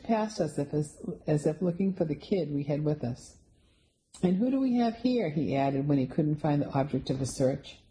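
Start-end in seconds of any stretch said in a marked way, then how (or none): 4.2-5.3 higher, cheery Santa voice; jolly Santa. A narrator's reading. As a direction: greet the kids as Santa. Santa greeting visitors.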